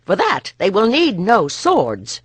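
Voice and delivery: angry guard. Acted. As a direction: threaten him out of the doorway. Nessa drives off a man.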